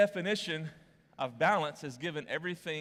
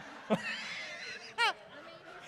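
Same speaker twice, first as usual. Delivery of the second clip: high breathy laugh. Real, not acted. Laughs after his joke.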